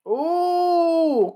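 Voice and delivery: a sudden high-pitched ooh at a big exchange; impressed high ooh. Real, not acted.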